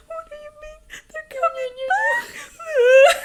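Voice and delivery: high-pitched squeal rising to a loud wail; hammed-up sobbing through laughter; mock sobbing. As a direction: imitate a tearful, incredulous reader losing it. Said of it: "I was sobbing, right?".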